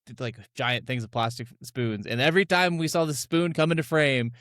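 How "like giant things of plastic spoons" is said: ordinary talk, nothing marked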